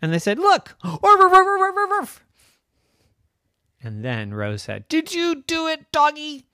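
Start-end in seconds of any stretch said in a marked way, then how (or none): 0.4-0.8 urgent exclamation. Acted. Family urges dog to look. 0.8-2.3 loud, high yapping; dog barking. Acted. Dog sees the jack-o'-lantern, barking. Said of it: "the dog came running and said". 4.9-6.5 high-pitched questioning; accusing Rose. Acted. Rose suspects her doggy, accusing.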